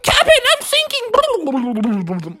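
high cry dissolving into bubbles; sinking, gurgled panic. A narrator's reading. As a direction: go under while calling out. Smee sinking, calls for help.

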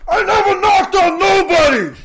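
high, full-out movie yell. Retold or imitated. [name] imitating Vin's outburst after the punch, yelling. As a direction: bellow it like an enraged action hero. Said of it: "and then he yells".

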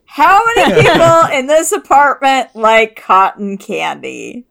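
loud slow call from the bathroom; from another room. Pretend. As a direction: call out from behind a door. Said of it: "I yell out of the, the bathroom".

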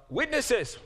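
loud, high one-word reply; ringing answer. Acted. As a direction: call it out as the whole crowd. The crowd answers Boaz.

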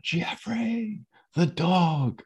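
high, imitated summons without real volume; calling out. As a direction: mimic calling someone over in a high voice. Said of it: "she calls out".

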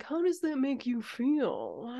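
slow, put-on therapist voice; mock therapist. Podcaster sending up herself as her friends' therapist, slow mock concern. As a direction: draw it out like a hammed-up therapist. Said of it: "I just sound like a therapist".

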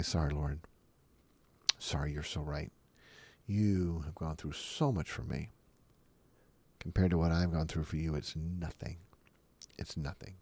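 low, soft, contrite apology; sheepish apology. Retold or imitated. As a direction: back down meekly before God. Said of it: "back up real quick and say".

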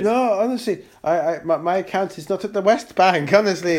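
put-on protesting in an Israeli accent. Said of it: "You do an Israeli accent".

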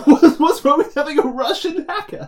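a retold huff of disbelief; mock indignant question. Podcast host retelling his reaction to the film, mock indignant. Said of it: "I was like".

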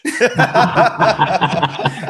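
high burst of laughter; real hearty laugh. Real, not acted. Storyteller laughing after the punchline of his coaching story, real laughter. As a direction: crack up at your own punchline.

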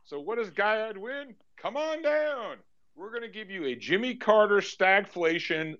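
slow, high showman announcing; game show host. Acted. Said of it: "let's tell Guyad what he wins".

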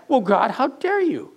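high, indignant mock accusation against God; mocking complainer. Preacher mimics a skeptic accusing God, indignant and high. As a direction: whine it up high like a sulky accuser. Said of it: "It sounds like".

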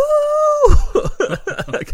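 high, loud laughing burst; burst of laughter. Real, not acted. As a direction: laugh out hard. Laughing after the cheer.

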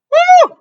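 loud high whoop; cheering woo. Real, not acted. Cheering the mother of seven.

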